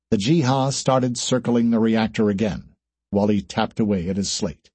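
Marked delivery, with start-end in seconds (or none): none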